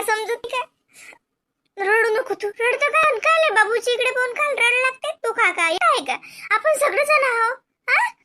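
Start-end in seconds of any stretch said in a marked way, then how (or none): 1.8-5.1 sister's high, urgent coaxing; pleading scold, higher. Acted. Sister scolds him for crying. 6.5-8.1 higher, with a laugh; laughing reassurance. Acted. Sister comforts with laugh.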